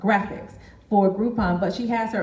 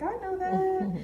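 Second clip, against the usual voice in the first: high, quiet mock voice of a listener who already knows; smug know-it-all; 12 semitones higher, 5 dB quieter than usual. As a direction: tease the audience in a high, hushed mimic. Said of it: "I see you, you're like".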